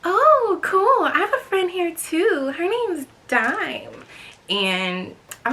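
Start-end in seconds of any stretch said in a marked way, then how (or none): 0.0-4.0 put-on much higher, girly greeting; imitating friend Vanessa's naturally high voice; high-pitched girl voice. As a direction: squeak it up like her friend. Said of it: "she's like".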